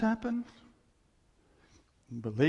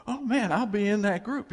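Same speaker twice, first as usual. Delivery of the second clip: higher, louder burst of eagerness; thrilled believer; 6 semitones higher, 6 dB louder than usual. Acted. Believer learning he is the bride, thrilled. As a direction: jump at it, thrilled.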